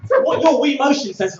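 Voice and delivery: high, loud laughing shout; frantic shout. Shown as a demonstration. Demonstrating Wii boxing to the crowd, laughing loud shout. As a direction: yell it like you're flailing.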